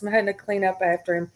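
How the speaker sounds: normal talking voice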